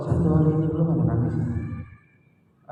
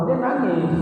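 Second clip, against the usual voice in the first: ordinary delivery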